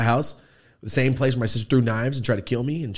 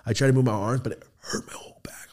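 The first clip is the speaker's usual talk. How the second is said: ordinary talk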